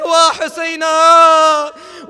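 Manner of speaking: long, slow, loud wail of Husayna; wailing lament. Acted. Mother beating her head wails for her son Hussein. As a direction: draw out the cry of mourning. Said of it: "begin to beat her head saying".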